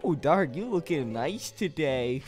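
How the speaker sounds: low, soft, slow compliment; smitten cooing. Pretend. Admirer flattering Dark on his looks, smitten cooing. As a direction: coo it softly and slowly. Fawning over Dark, teased as crush.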